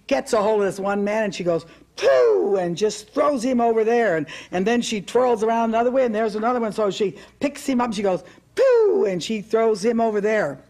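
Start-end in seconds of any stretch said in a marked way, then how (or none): 1.9-2.6 mock effort grunt. Demon woman throws a man. 8.5-9.0 squealed high "pooh" of effort; a sudden, much higher, louder grunt as she throws him; high shout. Retold or imitated. Preacher voicing the possessed woman flinging a man, high loud grunt.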